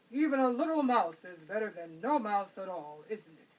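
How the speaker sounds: a hint of a hungry lion toying with prey. A narrator's reading. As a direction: savor the catch like a big cat.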